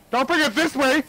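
panicked yelp. Real, not acted. Giant moth flying at them.

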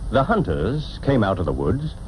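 usual speaking voice

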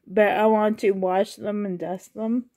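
ordinary talk, nothing marked

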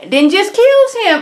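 high, loud burst of disbelief; shocked exclaim. Real, not acted. Viewer reacts to a killing, high and loud. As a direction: blurt out the twist in shock.

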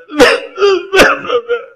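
loud, high burst of laughter. Real, not acted. Laughing at own story. (carcajada aguda)

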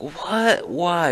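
high-pitched incredulous questions; retold shock, voice jumping way up; shocked protest. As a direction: yelp it in mock disbelief. Acted shock at trip ban.

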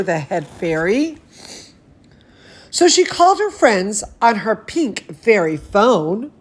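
ordinary delivery, nothing marked

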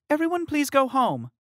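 high, loud firm announcement; manager's request. Acted. Manager sending guests home, firm announcement. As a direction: address the crowd firmly. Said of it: "the manager said".

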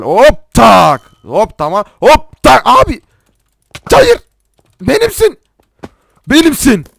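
high, loud bursts; excited battle shouts. Real, not acted. Player striking and claiming the enemy, excited shouting. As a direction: yell out each hit in short high cries.

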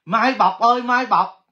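loud call to a viewer; loud hailing call. Real, not acted. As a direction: call her name across the room. Calling out to the winner.

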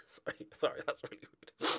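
hushed, breathy laughing apology, quick; giggling whisper. Real, not acted. YouTuber apologising to Arsenal fans while giggling.

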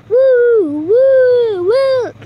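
high whoops while bouncing; on a trampoline. Real, not acted. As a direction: cheer on each bounce, loud and high. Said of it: "I'm bouncing on the little trampoline".

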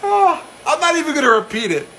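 high-pitched, just out of a laughing fit; amused refusal. Real, not acted. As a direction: refuse while still cracked up. Recovering from laughing fit, mock refusal.

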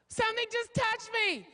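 put-on high, slow, alarmed girl voice. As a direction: squeal it slowly like a scared movie heroine.

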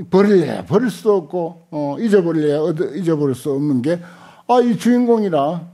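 ordinary talk, nothing marked

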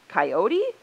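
loud "Coyote?" with rising end; surprised question. A narrator's reading. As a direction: question the name in disbelief. Humans react to the blame.

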